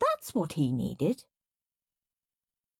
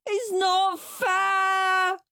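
high, slow, loud wail; slow crying child's wail; 4 dB louder, much slower than usual. Acted. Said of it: "wailed Henry".

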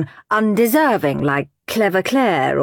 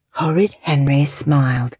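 usual speaking voice

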